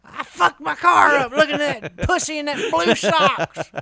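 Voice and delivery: loud high ranting yell; mock angry shout. Driver crashing from staring, hammed-up angry shout. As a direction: bellow the complaint.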